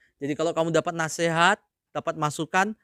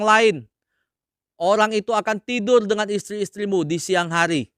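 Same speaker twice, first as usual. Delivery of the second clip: raised, declaiming Bible reading; scripture reading; 5 semitones higher, 5 dB louder than usual. Acted. Preacher reading God's word aloud, declaiming. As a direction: proclaim the verses to the congregation.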